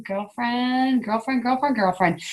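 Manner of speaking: quiet mock scolding; teasing. Real, not acted. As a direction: tease her gently. Teasing muted Anna.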